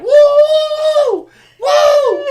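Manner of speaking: high loud pained whoops; pained hollering. Retold or imitated. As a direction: yelp from the burning. Burning armpit under water.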